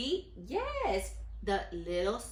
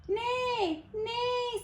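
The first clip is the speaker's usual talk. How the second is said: put-on high, playful neighing for the children; whinny it like a horse in a picture book. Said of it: "said the horse".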